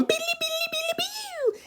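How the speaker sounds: very high soft beeps; high soft beeping guitar imitation; mock guitar notes. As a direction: squeak out the guitar line. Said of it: "little guitar going".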